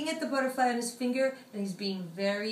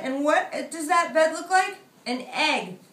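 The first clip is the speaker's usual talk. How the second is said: plain reading voice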